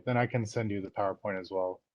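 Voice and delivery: normal talking voice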